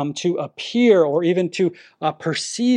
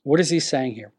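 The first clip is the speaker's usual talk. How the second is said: ordinary talk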